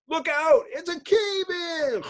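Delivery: panicked call of cave-in; alarmed shout. Acted. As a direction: yell the warning as the rock falls. Sudden cave-in warning.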